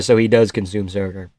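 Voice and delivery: ordinary talk, nothing marked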